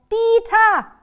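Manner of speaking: high, very loud call; shouting. A narrator's reading. Grandma shouts after the runaway dog, high yell. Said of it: "Sie schreit".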